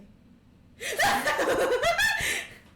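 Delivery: high, loud burst of laughter; real high-pitched laughing fit; big laugh. Real, not acted. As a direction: crack up hard at the joke. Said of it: "Whatever that means".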